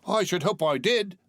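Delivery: high, cocky lad; the lad answering Scrooge, cheeky; cheeky boy. Acted. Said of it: "replied the lad".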